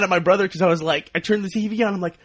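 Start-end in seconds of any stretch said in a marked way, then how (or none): none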